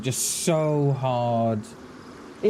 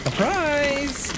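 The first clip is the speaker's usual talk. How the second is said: mock surprise; 11 semitones higher than usual. Playful shout of surprise.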